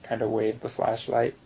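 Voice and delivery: ordinary talk, nothing marked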